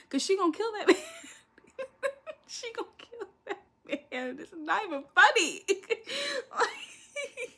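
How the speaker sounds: high voice breaking up with laughter; real laughing fit. Real, not acted. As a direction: barely get it out through the giggles. Cracking up over the scene.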